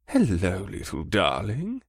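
put-on soft, slow coo to a baby. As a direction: coo gently at the little girl. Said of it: "Scourge cooed".

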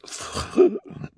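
high theatrical intake of breath; hammed-up awestruck gasp; excited gasp. Esme marvelling at pinstripes, excited gasp.

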